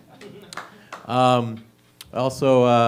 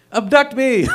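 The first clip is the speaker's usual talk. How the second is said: mock girlfriend voice; 10 semitones higher, slower than usual. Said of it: "her love language is like".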